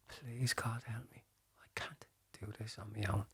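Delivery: hushed, intimate plea in an empty church; whispered prayer. Retold or imitated. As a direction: barely breathe the plea to God. Final prayer looking at statue.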